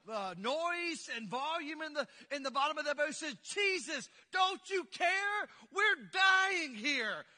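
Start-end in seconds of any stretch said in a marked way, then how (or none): 3.4-7.3 loud, higher, slowed panicked cry; panicked shout. Retold or imitated. Disciples waking Jesus in the storm, panicked shouting. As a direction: yell it as if the boat is sinking. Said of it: "there was some excitement, there was some anxiety".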